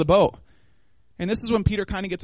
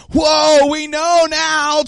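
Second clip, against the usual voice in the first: loud, high, slow gasp of wonder; awed exclaim; 7 semitones higher, 5 dB louder, much slower than usual. Retold or imitated. Disciples in the boat exclaim in awe, high and loud. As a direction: marvel out loud at what you saw.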